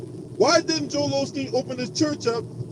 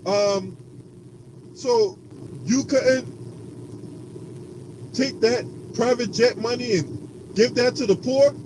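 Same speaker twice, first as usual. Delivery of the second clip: ordinary delivery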